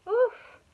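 high, loud storybook "oof"; sudden cartoon grunt of effort; effortful oof. A narrator's reading. Monkey toppling under heavy weights, loud oof.

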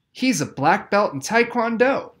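high, loud protest; indignant outburst. A narrator's reading. Deirdre defends Noah loudly.

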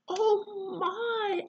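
startled little gasp of dismay. Acted. Mum remembering she forgot the blueberry pie, gasping dismay.

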